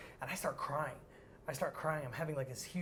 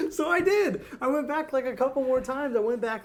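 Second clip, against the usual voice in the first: laughing; 10 semitones higher, 12 dB louder than usual. Real, not acted. Laughing while telling.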